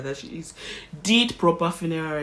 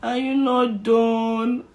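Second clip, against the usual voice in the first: high, slow, drawn-out complaint; impatient whine; 9 semitones higher, 7 dB louder, much slower than usual. Retold or imitated. Exhausted mother whining at doctor to finish stitching. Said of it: "next thing that came out of my mouth".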